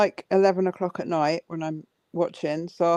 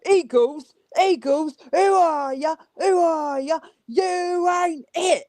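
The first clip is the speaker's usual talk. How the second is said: put-on British thug accent; 9 semitones higher, slower than usual. Caller [name] taunting Eagles fans in a British thug accent. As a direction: taunt the Eagles like a British football thug, slow on the last line. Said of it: "do your British thug accent".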